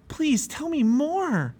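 high, slow, drawn-out plea; begging. A narrator's reading. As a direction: beg eagerly in a high voice. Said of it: "Pliable begged".

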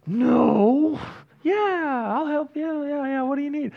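high, grudging yes after a no; reluctant helper. Retold or imitated. Interrupted while working, grudgingly agreeing to help. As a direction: fake willingness while interrupted. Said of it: "I'm like".

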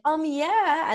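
high, loud, put-on brush-off; fake breezy yeah. Acting fine while being a psycho.

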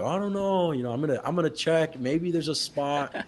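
high, singsong mock hedging; teasingly noncommittal. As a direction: play the buddy hemming and hawing, drawing it out. Retold buddy hedging on a job.

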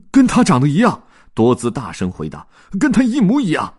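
high and loud; loud answer. Acted. Dodds answering the detective, loud and high. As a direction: call it out in exasperation.

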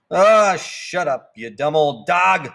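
slow, loud, fed-up call; calling out annoyed. Acted. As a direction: yell it out the window at the dog. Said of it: "I called".

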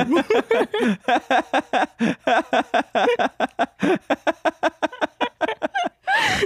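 long, high, uncontrolled laughing fit; helpless laughter. Real, not acted. Host cracked up by video comments, real laughing fit. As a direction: laugh until out of control.

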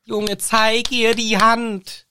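high, drawn-out plea; exasperated urging. Acted. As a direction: urge him desperately, stretching the words. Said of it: "ich denk mir die ganze Zeit".

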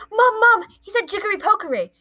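put-on high, loud bratty kid calling mom; whiny tattling kid. Dudley walks in tattling to his mom, high loud whine. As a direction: run to mom and tattle, whining.

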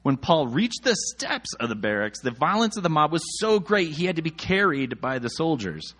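normal talking voice

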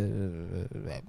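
low, soft grandpa murmur fading away; near-mute mumble. Acted. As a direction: imitate a man who barely talks. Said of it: "mi abuelo era como si fuera casi mudo". (murmullo bajo del abuelo casi mudo)

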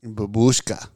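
babushka said low with a Russian accent. Shown as a demonstration. Russian accent, babushka said to a friend. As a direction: roll out babushka like a Russian grandmother. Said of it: "You must say it like babushka".

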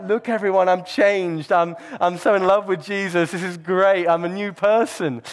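a mock-proud new convert announcing it to everyone; showy excitement. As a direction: show off how changed you are, hammed up.

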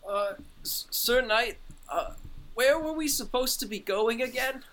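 high-pitched, hesitant little character voice; Fred's questioning voice. Acted. Fred asks Sir Knight the way, high and hesitant. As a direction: ask haltingly, pitched well up.

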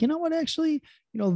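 a light, happy "oh wait" discovery; pleasantly surprised. Retold or imitated. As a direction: voice jumps high with a soft, sudden realization. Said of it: "you'll surprise yourself and be like".

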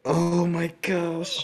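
high, slow, drawn-out villain voice; Frieza voice. Acted. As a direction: stretch it out like Frieza. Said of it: "do my best at Frieza".

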